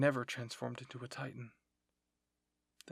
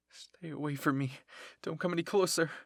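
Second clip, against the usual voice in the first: louder, urgent plea; frightened warning; 3 semitones higher, 7 dB louder than usual. Acted. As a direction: fend them off in rising alarm. Cornered character fending off titans.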